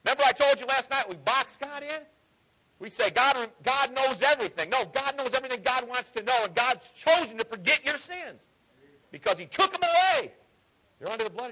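high, loud preacher's call; preaching at full force. Acted. Preacher driving a point home to the church, high and loud.

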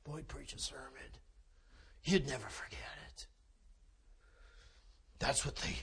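very soft, low near-whisper; hushed aside. Acted. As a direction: drop right down to a hush. Hushed aside after the screamed plea.

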